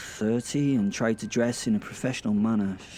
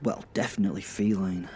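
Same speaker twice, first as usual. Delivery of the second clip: plain reading voice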